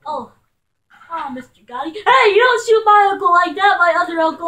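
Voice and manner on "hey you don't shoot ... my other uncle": loud higher protest, easing as it goes; shouted protest. Pretend. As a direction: yell in protest, then start to settle. Protests uncle being shot in game.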